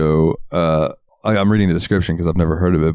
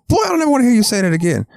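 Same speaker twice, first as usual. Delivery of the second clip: very high, rapid mock scolding; hammed-up outraged old man; scolding grandpa; much faster than usual. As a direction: tear into the kid as a cartoon grandpa.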